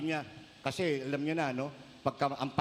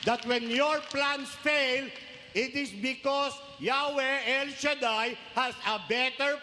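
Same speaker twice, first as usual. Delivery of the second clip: slow, raised solemn proclamation; preaching; 6 semitones higher, much slower than usual. Acted. Priest assures the congregation God has better plans, slow and solemn. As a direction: proclaim it slowly like a promise from the pulpit.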